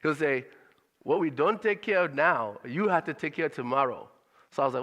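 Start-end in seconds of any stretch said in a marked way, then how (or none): 1.0-4.1 put-on father's Liberian accent. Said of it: "I'm gonna try to do the accent".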